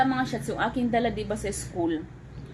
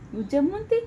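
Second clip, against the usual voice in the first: high sing-song praise; 6 semitones higher than usual. Shown as a demonstration.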